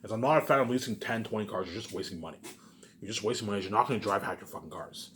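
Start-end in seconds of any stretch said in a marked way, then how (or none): none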